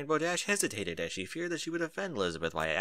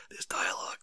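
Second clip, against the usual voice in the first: 1 whispered laughing aside; 5 dB quieter than usual. Real, not acted. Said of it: "It's like two N P Cs".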